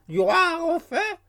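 very high, slow squawk; excited raven cry. A narrator's reading. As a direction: screech it like a thrilled raven. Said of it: "cria Grip".

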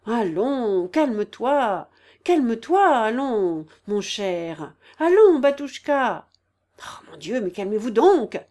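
loud, drawn-out exasperated calming; angry cry. A narrator's reading. General's wife to the sobbing patient, angry cry. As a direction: order him to calm down, loudly losing patience. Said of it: "cria avec colère la générale".

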